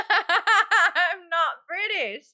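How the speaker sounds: loud, high burst of laughter through the words; laughing. Real, not acted. Streamer laughing at booking a British commercial, laughing hard. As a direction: crack up loudly, drawn out.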